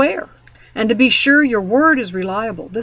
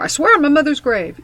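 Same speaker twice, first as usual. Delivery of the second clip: flippant oath; 5 semitones higher, 6 dB louder than usual. Retold or imitated. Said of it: "in a flippant way".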